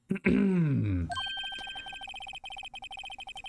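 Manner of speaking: a huge, high, theatrical ahem; over-the-top throat clearing. Acted. Pompous lawyer announcing himself with a hammed-up ahem. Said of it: "the most over-the-top clearing of the throat".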